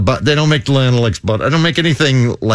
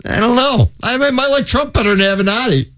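high-pitched retold quote; Stormy Daniels; 11 semitones higher than usual. Host retells her verdict on Avenatti, high put-on voice. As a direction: voice a doubtful woman, pitched well up. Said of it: "Stormy Daniels says".

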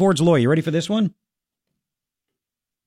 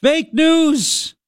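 scoffing; 9 semitones higher than usual. Real, not acted. Repeated derisive outburst.

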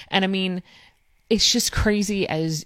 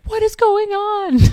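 much higher, louder, slow disbelieving cry; bewildered wail; 8 dB louder, slower than usual. Retold or imitated. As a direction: throw it up like a stunned wail. Her bewildered reaction, retold.

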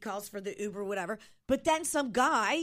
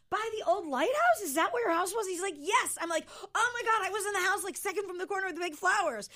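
retold excitement; 8 semitones higher than usual. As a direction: relive the thrill of the coincidence. Retold excited recognition with stranger.

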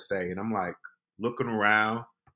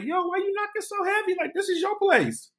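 much higher, baffled asking; incredulous question. Retold or imitated. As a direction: ask in disbelief why he knocks.

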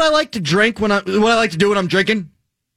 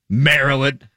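ordinary talk, nothing marked

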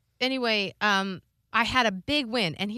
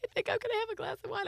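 much higher, fast, sing-song mock mother; chirpy mother; 13 semitones higher, 6 dB quieter, much faster than usual. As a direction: rattle it off brightly like a pleased mom. Mother voiced, chirpy about wine.

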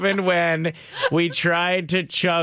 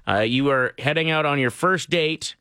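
ordinary delivery

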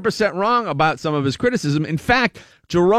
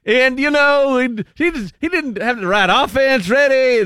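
mock Louisiana accent, pitched up in complaint; Terry Bradshaw; 7 semitones higher than usual. Host mimicking the pundit's gripe about the team, exaggerated Louisiana accent. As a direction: do a high, griping Louisiana football pundit. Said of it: "Like, he's going".

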